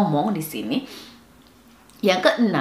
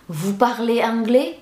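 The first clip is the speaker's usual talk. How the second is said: higher-pitched question climbing on "anglais"; slow question with "anglais" rising; rising intonation; 5 semitones higher, slower than usual. Shown as a demonstration. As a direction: lift "anglais" upward at the end. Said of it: "Intonasinya naik".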